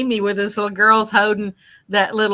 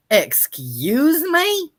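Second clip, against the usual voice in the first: sassy excuse me; 6 semitones higher, much slower than usual. Retold or imitated. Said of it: "Miss P R says".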